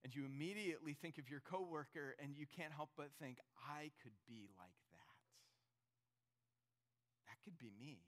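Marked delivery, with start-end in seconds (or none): 3.7-8.0 hushed realization. Acted. Woman recognizing her own pickiness, hushed and low. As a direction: lower and much softer.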